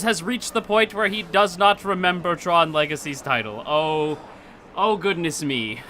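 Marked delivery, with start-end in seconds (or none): none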